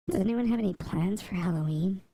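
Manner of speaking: ordinary talk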